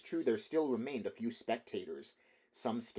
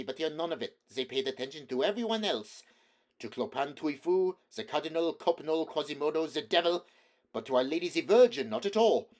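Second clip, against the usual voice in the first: building to high, loud outrage; indignant rant; 6 semitones higher, 7 dB louder than usual. A narrator's reading. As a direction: rant louder with each name. Poet ranting on stairs.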